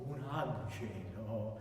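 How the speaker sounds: "angelo" held long and low; tenuto. Shown as a demonstration. Coach shows the marking as a tenuto, "angelo" sustained. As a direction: draw out each syllable of "angelo" slowly. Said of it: "it can be a tenuto".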